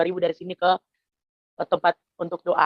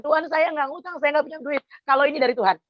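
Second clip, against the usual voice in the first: high, pleading prayer voice; raised voice, begging God to provide without debt; fervent prayer; 9 semitones higher than usual. Retold or imitated. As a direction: cry out to God in earnest faith. Retold prayer repeated after "saya bilang.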